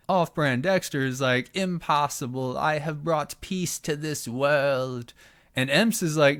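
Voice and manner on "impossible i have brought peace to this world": slow, eerie, a little higher; creepy voice. Acted. Son insists to his father, slow and creepy. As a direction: stretch it out like a deluded prophet. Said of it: "Why are you so fucking creepy".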